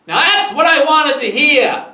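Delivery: high, loud approval; satisfied calling back. A narrator's reading. Kenneth calls back across lawn.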